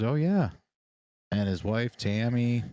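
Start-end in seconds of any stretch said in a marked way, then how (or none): 0.0-0.5 higher, casual reply; imitating the stranger. Stranger in the elevator answering, light higher voice. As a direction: toss it off lightly. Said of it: "And he goes".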